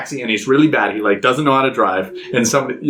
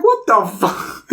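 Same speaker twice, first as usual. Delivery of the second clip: high, drawn-out disbelief; imitating bafflement; 9 semitones higher, slower than usual. Sister-in-law watching a clown play recorders, baffled. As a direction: stretch it out high, mock baffled.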